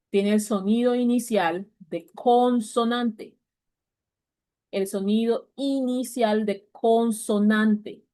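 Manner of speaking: slow, deliberate phrasing that leans on "consonante"; teacher hammering home a grammar rule; emphatic teaching. Shown as a demonstration. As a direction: spell out the rule slowly so it sticks. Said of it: "tengo que ser enfática".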